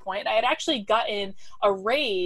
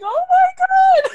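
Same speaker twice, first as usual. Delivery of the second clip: much higher, louder and slow, drawn out; shocked exclamation; 10 dB louder, slower than usual. Retold or imitated. Reading the press release in a hotel room, shrieking in disbelief. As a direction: shriek it in stunned delight. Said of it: "I died. I was like".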